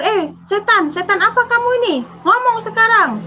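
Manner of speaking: high, fast, fierce demand; shouting down a ghost without fear; defiant challenge. Retold or imitated. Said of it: "saya tantang waktu itu".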